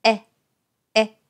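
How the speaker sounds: "え" with lips pulled wide sideways; short, wide "え"; spread-lip e. Shown as a demonstration. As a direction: stretch the corners of the lips on "え". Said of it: "さらに左右に唇を引いて".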